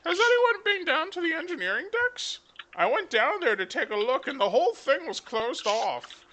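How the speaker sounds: very high, put-on whiny tourist voice; indignant complaining passenger. Game master voicing a rumor for Sarah, high indignant passenger. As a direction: complain in a high-pitched cartoonish voice, building to a demand. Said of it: "Sarah, your rumor".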